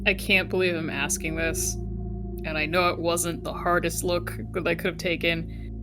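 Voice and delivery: ordinary talk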